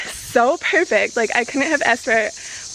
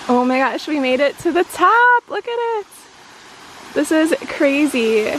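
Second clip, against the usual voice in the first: excited shout; 4 semitones higher than usual. Real, not acted. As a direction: cry out in delight at the view. Reaching the top.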